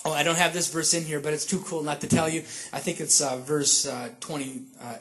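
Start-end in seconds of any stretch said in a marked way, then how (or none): none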